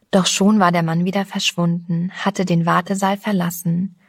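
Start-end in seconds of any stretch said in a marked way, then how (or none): none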